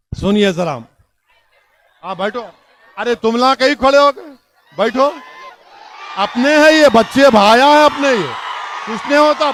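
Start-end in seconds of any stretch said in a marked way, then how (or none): none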